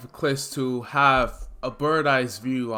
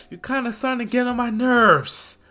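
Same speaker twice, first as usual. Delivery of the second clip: higher, louder menace; growing villain annoyance; irritated threat; 9 semitones higher, 5 dB louder than usual. Acted. Villain threatening Izuku, higher and louder.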